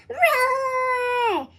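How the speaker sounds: high playful roar; dinosaur roar. A narrator's reading. Froggy playing his snow dinosaur, playful roar. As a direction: roar like a kid's pretend dinosaur. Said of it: "went Froggy".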